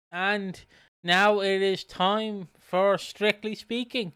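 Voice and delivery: slow, loud show-host intro; segment announcement. Acted. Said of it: "announces segment".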